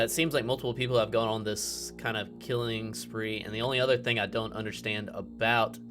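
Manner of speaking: ordinary talk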